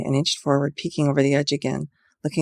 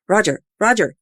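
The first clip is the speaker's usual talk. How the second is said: louder call, lightly voiced man; bellowed; 6 semitones higher, 5 dB louder than usual. A narrator's reading. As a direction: bellow his name. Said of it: "he bellowed".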